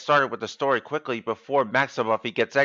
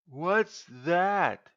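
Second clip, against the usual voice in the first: nervous; 8 semitones higher than usual. Acted. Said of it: "he asked nervously".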